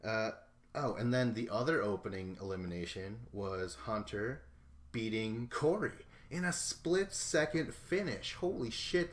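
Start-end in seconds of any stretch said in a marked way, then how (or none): 4.9-9.1 higher pitched, slowed, disbelieving; real excitement at a shock finish; excited. Real, not acted. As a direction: marvel at it.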